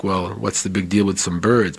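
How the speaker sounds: usual speaking voice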